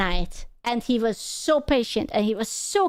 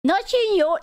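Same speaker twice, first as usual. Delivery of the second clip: ordinary talk, nothing marked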